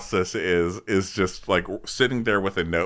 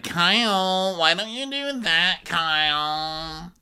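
high, drawn-out whine; mock whining fiancée; 8 semitones higher, slower than usual. Fiancée nagging Kyle to join the dancing, mock whining. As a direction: stretch the name into a pleading mock whine.

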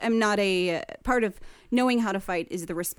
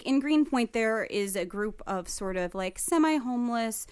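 usual speaking voice

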